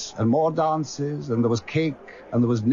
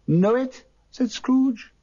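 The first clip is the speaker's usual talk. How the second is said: surprised; 10 semitones higher, slower than usual. Acted. Scrooge exclaims.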